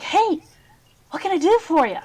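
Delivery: high, loud, beaming hello; young shopkeeper greeting customers with a big grin, cheerful and bright; cheery shopkeeper greeting. Acted. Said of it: "He's like".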